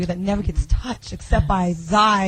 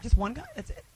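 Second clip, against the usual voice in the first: sexy voice; 9 dB quieter than usual. Pretend. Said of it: "let's use our sexy voices".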